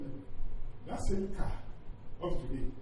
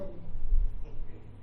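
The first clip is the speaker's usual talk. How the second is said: laughing; 3 dB louder than usual. Real, not acted. Laughing at his wife's question.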